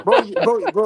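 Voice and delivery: high and loud, laughing through words. Real, not acted. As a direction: laugh while saying bro. Laughing at the banter.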